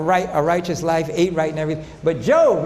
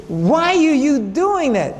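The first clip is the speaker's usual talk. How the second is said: slow, higher, pointed challenge; slow, pointed why-would-you; incredulous rebuke; 6 semitones higher, 3 dB louder, much slower than usual. Real, not acted. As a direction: drag it out in disbelief. Rebuking medical missionaries.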